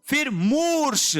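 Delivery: teacher drags out मूर्ष; long vowel stressed. Shown as a demonstration. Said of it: "फिर दीर्घ है".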